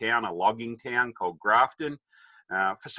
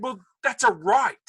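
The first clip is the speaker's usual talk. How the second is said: high, louder mock retort; cheeky teenage protest; 13 semitones higher, 5 dB louder than usual. Son talking back to dad, mock entitlement. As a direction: insist on it as an entitled kid.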